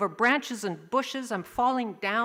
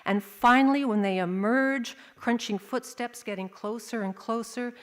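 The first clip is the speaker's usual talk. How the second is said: usual speaking voice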